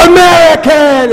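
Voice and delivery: loud, high, drawn-out holler; stretched-out game-show yell; triumphant mock shout. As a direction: belt out the answer like a winner. Blurting the punchline answer.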